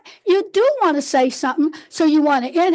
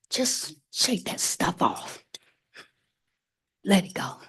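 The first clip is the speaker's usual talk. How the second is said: soft and low; 7 semitones lower, 8 dB quieter than usual. Shown as a demonstration. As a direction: breathe the words out gently. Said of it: "guiding release softly".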